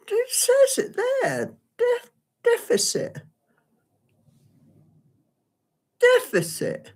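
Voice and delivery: mock whimper. Struggling to pronounce the word.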